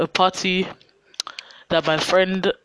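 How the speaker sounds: normal talking voice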